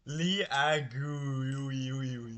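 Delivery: slow, drawn-out TTS syllables; text-to-speech imitation. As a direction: mimic a text-to-speech voice stretching out nonsense. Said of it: "exactly like how T T S said it".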